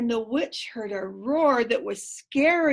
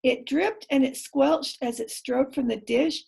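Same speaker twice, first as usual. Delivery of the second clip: ordinary delivery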